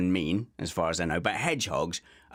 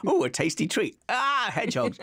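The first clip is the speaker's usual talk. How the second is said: mock dog delight; 12 semitones higher than usual. Voicing the dog's delight.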